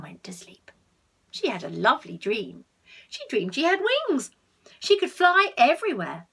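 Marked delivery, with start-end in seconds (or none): 0.0-0.7 sleepy whisper. A narrator's reading. Bedtime story, cat falling asleep. 4.8-6.3 higher and louder with wonder; excited storytelling. A narrator's reading. Telling a child about the flying dream, excited lift.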